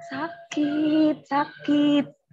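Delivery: drawn-out mock whimper of pain; retold crying in pain. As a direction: imitate crying out at night. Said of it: "kita nangis mulu". (menirukan tangisan kesakitan)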